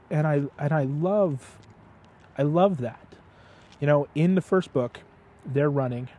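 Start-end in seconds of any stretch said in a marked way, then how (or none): none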